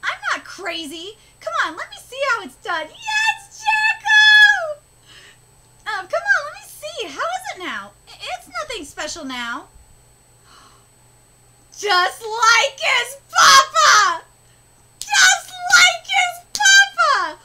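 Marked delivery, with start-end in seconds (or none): 2.9-4.8 high, loud, slow crying scream; fangirl scream. Real, not acted. Fan screaming at a favourite character, excited. 11.7-14.2 loud, slow crying shriek; gasping shriek. Real, not acted. Fan shrieking at a son resembling his father, overjoyed. 15.0-17.4 high, loud, slow crying shriek; higher shriek. Real, not acted. Fan shrieking again at the resemblance, overjoyed.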